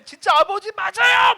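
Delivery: high, loud shout to the Father; crying out. Acted. Pastor showing how to cry out to God, shouting. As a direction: cry out to God in raw protest. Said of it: "저 같으면 막 외치겠어".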